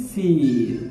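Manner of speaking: সিল drawn out as one low beat; second syllable alone. Shown as a demonstration. Teacher shows the next syllable, সিল held as a separate beat. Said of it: "পরের বার কি করতেছি".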